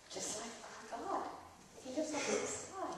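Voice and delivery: soft, hushed little remark; quiet aside. Real, not acted. Child quietly comparing the story gods to their God, hushed. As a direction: murmur it under the story.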